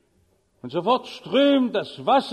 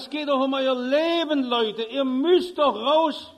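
louder pleading shout; 5 semitones higher, 3 dB louder than usual. Acted. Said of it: "brüllt lauter".

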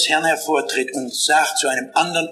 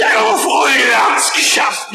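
high, loud, jubilant roar; soldier's roar; 10 semitones higher, 5 dB louder than usual. Retold or imitated. Sweaty infantryman at Verdun roars his triumph at the narrator, loud and high. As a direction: roar out the victory. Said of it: "Wie er brüllt".

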